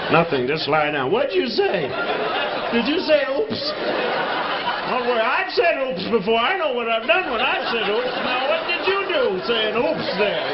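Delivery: patient questioning the surgeon; worried patient. Retold or imitated. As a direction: play a nervous patient. Patient and doctor dialogue.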